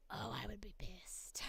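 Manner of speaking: unvoiced whispered aside; whispered half-joke. Real, not acted. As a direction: hush it like a confession. Admits she would be angry.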